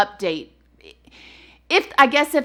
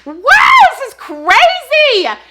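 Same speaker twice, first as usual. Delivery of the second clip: slow, much higher, louder cry of wonder; amazed; 13 semitones higher, 10 dB louder, much slower than usual. Real, not acted. Host to listeners about the killer's match, stunned and amazed. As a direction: let the shock burst out, drawn out.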